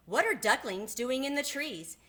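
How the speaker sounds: usual speaking voice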